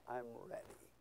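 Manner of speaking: very soft, low murmur; hushed quote. Retold or imitated. Dying believer tells a visitor, hushed and low. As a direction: say it barely audibly, voice dropped low. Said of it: "they're smiling at you and telling you".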